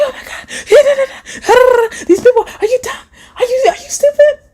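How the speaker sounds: very high-pitched hammed-up freak-out; squealing, exaggerated outrage; mock hype rant. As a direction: imitate yourself ranting way too hyped. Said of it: "sometimes I come on here hype".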